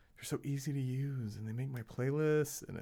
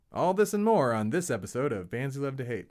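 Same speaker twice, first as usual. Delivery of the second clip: announcer sign-off; 2 semitones higher, 7 dB louder than usual. Acted. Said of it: "That's what I say".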